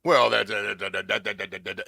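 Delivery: sputtering mock protest, repeating the word; imitating stammered objection. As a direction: start loud, then stutter and tail off. Mimics people stammering objections.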